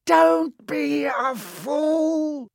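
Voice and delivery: very high, slow, loud villain snarl. A narrator's reading. The face warns Harry against resisting, snarling. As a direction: snarl each word, drawn out and high. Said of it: "snarled the face".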